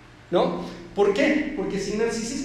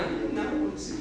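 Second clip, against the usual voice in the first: dull bystander; 6 semitones lower, 6 dB quieter than usual. Retold or imitated. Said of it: "y todos decían".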